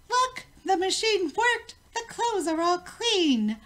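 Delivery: high, loud triumphant call; joyful shout. A narrator's reading. Queen shouts as she pulls out clean clothes, thrilled. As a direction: shout it out with delight. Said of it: "the queen shouted".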